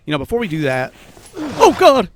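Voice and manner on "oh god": sudden high frightened cry; startled yelp. Real, not acted. Jumped by a zombie.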